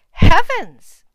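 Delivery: high, loud gasp of horror; shrieked exclamation; shocked cry. A narrator's reading. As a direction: cry out in dismay at the filth. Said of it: "cries the farmer's wife".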